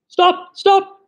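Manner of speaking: high urgent shouted stop; sharp cry. Acted. Nurse shouting at the runaway boy, high and urgent. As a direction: call the child back sharply. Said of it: "cried Nursey".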